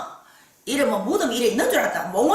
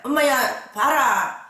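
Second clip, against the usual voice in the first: ordinary talk, nothing marked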